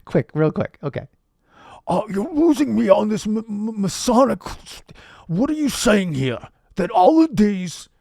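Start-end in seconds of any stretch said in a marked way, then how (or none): 1.9-7.9 put-on hector them like a baffled old politician; Bernie Sanders. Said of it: "Hey, Bernie".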